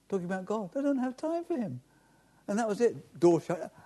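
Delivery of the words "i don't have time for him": a sweet, polite brush-off, higher; sweet refusal. Retold or imitated. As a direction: politely shut the door on him, sweetly. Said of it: "She said sweetly".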